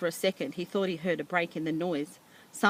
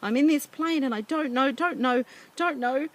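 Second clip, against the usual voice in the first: high, loud panicked cries for help; screaming into the mic in terror; a boy lost in a plane, crying out; 8 semitones higher, 4 dB louder than usual. A narrator's reading. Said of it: "began to scream into the microphone".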